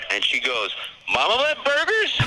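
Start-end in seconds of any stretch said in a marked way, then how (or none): 1.1-2.2 very high, loud, drawn-out Southern squawk; exaggerated high Southern drawl; thick Southern accent. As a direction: imitate a Southern counter woman, slow and garbled. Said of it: "she had this thick Southern accent".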